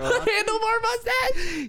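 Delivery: very high, slow; mock high voice. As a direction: stretch it out squeaky and high. Joking about bartender look.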